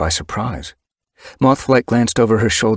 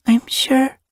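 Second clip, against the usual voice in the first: small kit voice; 3 dB louder than usual. Acted. Kit answers.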